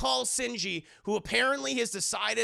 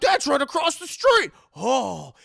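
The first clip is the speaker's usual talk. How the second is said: very high, loud shout of surprise; shouted discovery; 7 semitones higher, 11 dB louder than usual. Retold or imitated. Reporter spotting the address on the list, shouting in excitement. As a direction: yell it at the top of the voice.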